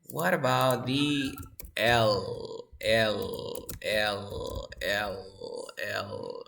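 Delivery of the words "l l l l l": "L" with the final sound held; voiced L sound. Shown as a demonstration. Teacher quizzing students on L, held voiced L. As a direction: repeat "L" lower, lingering on it. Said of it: "Voice or unvoiced?".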